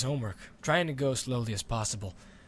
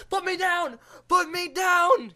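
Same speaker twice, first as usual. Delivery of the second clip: high, loud panicked shriek; terrified yell; 7 dB louder, slower than usual. Acted. Boy screaming at the dragon mid-flight, panicked. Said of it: "he yelled".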